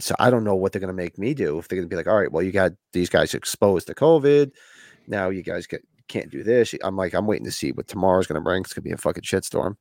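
ordinary talk, nothing marked

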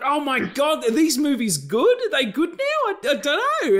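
high, loud, laughing disbelief; mock a movie for chickening out; mock incredulous rant. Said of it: "a little bit agitated there".

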